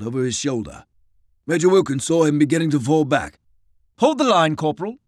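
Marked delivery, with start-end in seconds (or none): 4.0-5.0 officer command. Acted. Major orders a man.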